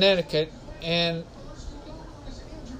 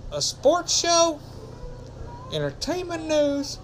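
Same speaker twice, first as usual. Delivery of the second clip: high excited pitch; 10 semitones higher than usual. Acted. Listing show options.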